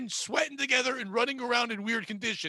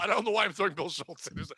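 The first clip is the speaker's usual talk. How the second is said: ordinary talk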